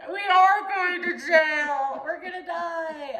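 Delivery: hammed-up terror about jail; mock panic. Teller acts her panic in the back seat about going to jail. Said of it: "I'm like".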